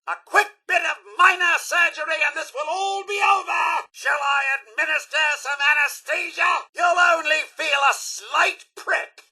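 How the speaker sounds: high, sing-out surgical patter; brightly officious medical robot; eager robot surgeon. Acted. Robot surgeon readies patient for operation.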